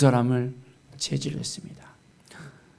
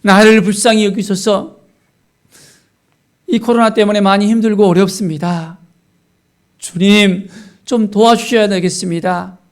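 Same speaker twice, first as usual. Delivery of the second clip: higher, pleading prayer voice; earnest prayer; 4 semitones higher, 4 dB louder than usual. Shown as a demonstration. Worn-out believer praying during corona hardship, earnest plea. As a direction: beg the Lord for help, voice lifted. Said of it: "간절하게 주님 앞에 나아가면".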